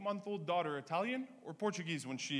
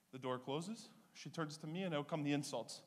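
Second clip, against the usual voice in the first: ordinary talk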